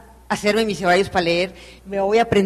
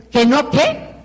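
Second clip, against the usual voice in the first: a mild mock flare of indignation; indignant retort; 3 semitones higher, much slower than usual. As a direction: snap the challenge right back. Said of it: "el colérico de la Charo".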